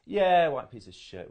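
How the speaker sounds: a street insult hurled back in a loud mock yell; imitated shouted insult. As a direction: bark the slur out loud and high, as the abusers did. Retelling abuse shouted at him.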